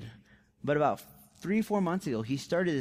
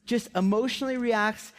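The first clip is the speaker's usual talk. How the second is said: normal talking voice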